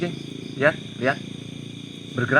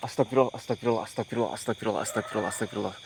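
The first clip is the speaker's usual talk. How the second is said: fading frightened prayer; 5 semitones lower, 6 dB quieter, faster than usual. Real, not acted. Ghost hunter sees a figure.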